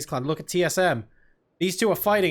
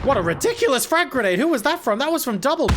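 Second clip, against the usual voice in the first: high-pitched, thrilled burst of play-by-play; amazed commentary; 7 semitones higher, 3 dB louder than usual. Real, not acted. Caster marvels at a ridiculous frag grenade, amazed. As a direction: react in excited amazement, pitch jumping up.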